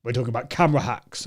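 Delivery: low, quick, unsmiling delivery; deadpan serious presenter. Shown as a demonstration. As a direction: drop it low and solemn. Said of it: "I was speaking very seriously like that".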